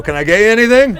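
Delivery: high, loud host-like offer; mock waiter voice. Terrified guy offering a stranger service, high and loud. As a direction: play the eager host. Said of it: "Sounds very casual".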